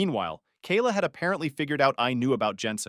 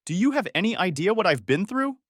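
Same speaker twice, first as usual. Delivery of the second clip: shrieking; 7 semitones higher than usual. A narrator's reading. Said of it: "she shrieked".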